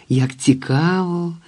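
high, slow exclamation of interest; intrigued exclamation. A narrator's reading. Women react to the lodger's unusual occupation, intrigued exclamation. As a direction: draw it out with wonder, without shouting. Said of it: "вигукнули жінки".

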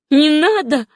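high, loud protest; almost shouting. A narrator's reading. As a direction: burst out, just short of a shout. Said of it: "почти крикнула та". (почти крича)

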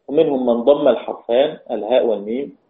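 ordinary delivery

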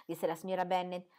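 normal talking voice

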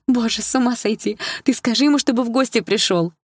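high, animated teacher's exclamation; excited, relieved older friend; amazed delight. A narrator's reading. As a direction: exclaim it with lifted pitch. Teacher overjoyed at astonishing news.

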